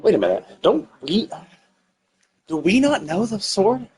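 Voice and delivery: normal talking voice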